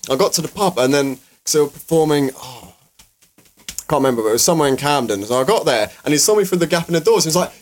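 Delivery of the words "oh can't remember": normal talking voice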